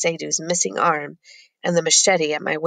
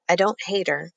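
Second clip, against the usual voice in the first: ordinary delivery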